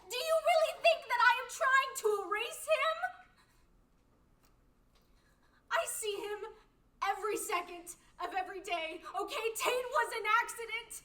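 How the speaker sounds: loud, high, sobbing rage; furious tearful shout. Acted. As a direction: scream your grief at him. Grief argument with Howie.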